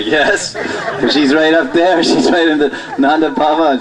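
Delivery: normal talking voice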